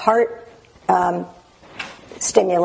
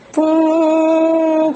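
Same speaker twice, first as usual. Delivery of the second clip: higher, louder burst; excited boom; 8 semitones higher, 4 dB louder than usual. Retold or imitated. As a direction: pop it out like a sudden success. Sudden shift into green.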